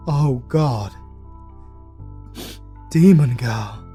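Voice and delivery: ordinary talk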